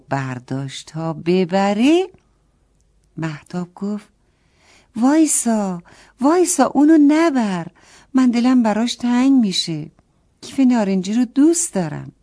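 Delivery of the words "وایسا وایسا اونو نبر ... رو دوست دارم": higher and louder, stop her leaving; urgent pleading. A narrator's reading. Mahtab begs the neighbour girl not to take the bag, higher and louder. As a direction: beg her to wait, a child's cry.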